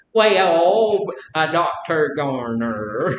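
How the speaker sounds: a light, slow Alabama drawl, slow on Doctor Garner. Retold or imitated. Teacher teasing student Kim about her slow Alabama speech, mock drawl. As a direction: lean into a lazy Alabama drawl. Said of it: "and Kim does the same thing".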